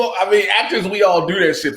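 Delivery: ordinary talk, nothing marked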